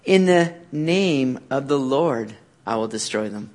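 soft and slow, reading the verse; quiet reading. Shown as a demonstration. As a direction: read it hushed and low. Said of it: "What does he say all through those verses".

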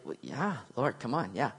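soft, low, offhand yes; casual assurance. Acted. Peter first answers Jesus' question, casual and quiet. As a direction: brush it off gently, keeping the voice down.

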